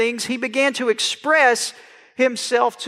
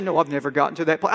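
mock pious; 9 semitones lower, 3 dB quieter, faster than usual. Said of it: "I wish I could be all spiritual and say".

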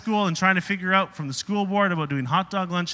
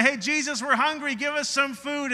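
higher pleading crowd voice; whiny crowd; 7 semitones higher than usual. Retold or imitated. A pastor voicing the hungry crowd, higher and pleading. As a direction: act out the hungry crowd begging Jesus.

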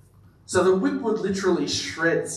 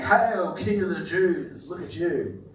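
sarcastic mockery; 3 semitones higher, slower than usual. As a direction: hail them with scorn, letting it fall away. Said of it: "would have said it sarcastically".

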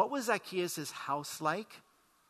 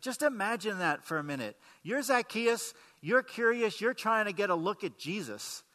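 normal talking voice

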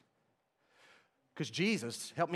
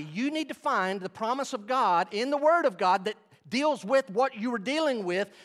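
usual speaking voice

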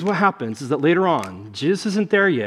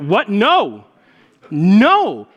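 higher, louder retold protest; shocked denial; 5 semitones higher, 6 dB louder, much slower than usual. Pastor retelling his reply to dad's question, shocked denial. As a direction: deny it in mock horror.

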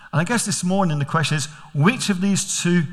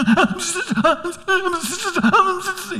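put-on high, nervous little-boy giggle; squeaky guilty giggle; nervous child giggle; 13 semitones higher than usual. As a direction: giggle like a scared kid. Son nervously giggling at the door.

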